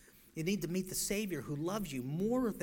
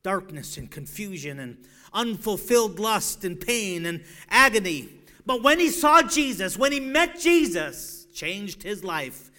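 full-force preaching; 5 semitones higher, 15 dB louder than usual. Acted. Preacher thundering at the lost, full-force preaching.

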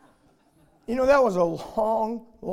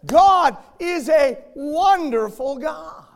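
higher and louder, ringing; preaching at full force; 7 semitones higher, 9 dB louder than usual. Acted. Preacher gives thanks for undeserved grace, ringing preaching voice. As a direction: testify with joy from the pulpit.

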